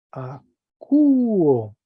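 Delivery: teacher sounding Acuo clearly; Greek verb modeled. Shown as a demonstration. Teacher demonstrates Greek verb to students. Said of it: "espíritu suave en la A".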